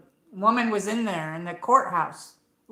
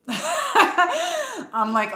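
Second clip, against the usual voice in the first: stretched out, laughing hard; 5 dB louder than usual. Real, not acted. To her viewers, recalling jury dread, laughing. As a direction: laugh your way into the line.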